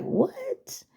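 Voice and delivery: high, breathless whispered disbelief; a stunned, whispered "What?". Retold or imitated. Friend reacting to the song, whispered surprise.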